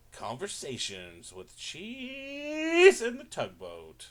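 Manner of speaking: big radio announcer reading the title; show announcer. Acted. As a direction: announce the show name grandly.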